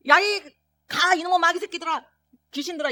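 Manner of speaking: high, loud cursing at the demons; angry yell. Retold or imitated. Father shouting at the demons, angry yell. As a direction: drive them off with rage.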